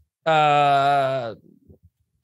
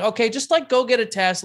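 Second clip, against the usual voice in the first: exasperated plea; 9 semitones higher than usual. Retold or imitated. Exasperated urging of cousin.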